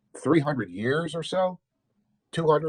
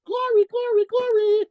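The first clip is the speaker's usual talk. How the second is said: tiny bird voice, much higher, chirping each glory; mock a little bird singing praise; chirping birdie. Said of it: "all the while chirping".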